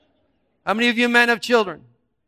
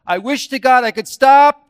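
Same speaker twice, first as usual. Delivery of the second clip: pleading inner voice; 5 semitones higher, 11 dB louder than usual. Acted. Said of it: "think about that".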